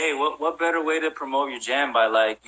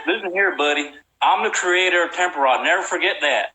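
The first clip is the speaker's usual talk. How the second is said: put-on Southern accent; 3 semitones higher than usual. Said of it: "Do your Southern accent".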